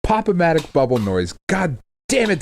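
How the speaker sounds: normal talking voice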